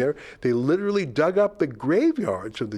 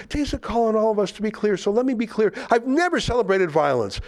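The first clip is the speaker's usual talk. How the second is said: high, hammed-up declaration; mock earnestness; 8 semitones higher than usual. Host reads an activist's statement, mock-earnest. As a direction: pitch up the solemn protest in mockery. Mockingly reading activist's defensive tweets.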